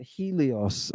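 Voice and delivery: Helios as "HEE-lee-os"; English pronunciation. Shown as a demonstration. Teacher giving the class the English form, Helios as "HEE-lee-os". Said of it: "I guess you say in English".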